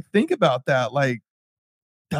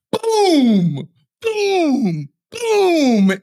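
imitated booming; 14 semitones higher, much slower than usual. Imitating the kicks on the door.

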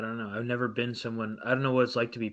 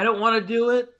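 higher and louder, a mock whine; whiny protest; 9 semitones higher, 10 dB louder than usual. Mocking a reluctant YouTuber, loud whiny protest. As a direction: complain it out loud like a sulking creator.

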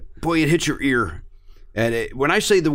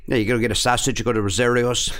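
hammed-up South Side Chicago talk; Chicago accent; 2 semitones lower than usual. Local recommends a sausage place, thick Chicago accent. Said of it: "Yeah, it's awful".

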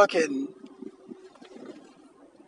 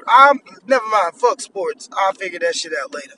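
normal talking voice

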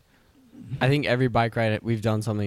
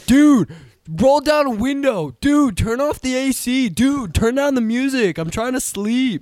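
whiny road trip companion; 11 semitones higher, 4 dB louder than usual. Retold or imitated. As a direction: nag like a cranky passenger stuck in a car. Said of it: "it's like".

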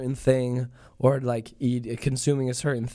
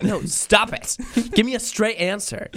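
high, mock-exasperated demand; 10 semitones higher, 4 dB louder than usual. Prince demands a straight answer, high and exasperated. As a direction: plead loudly for sense, hammed-up. Acted prince fed up with accents.

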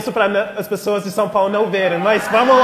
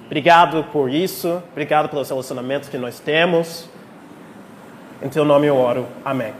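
prayer; 4 semitones lower than usual. Acted. Leads a prayer.

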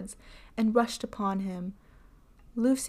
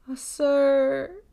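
louder, pleading cry; desperate plea; 5 semitones higher, 7 dB louder than usual. A narrator's reading. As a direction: beg him for mercy. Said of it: "she besought him".

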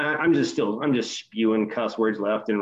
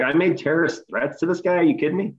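higher-pitched incredulous question; retold disbelief; 5 semitones higher than usual. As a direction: throw the accusation back in disbelief. Retold disbelieving reply to accusation.

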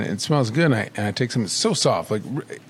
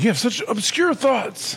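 high, slow, drawn-out amazement; acted amused amazement; 9 semitones higher, slower than usual. Boss started laughing at his musing, acted amused amazement. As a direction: imitate someone marveling at an odd mind.

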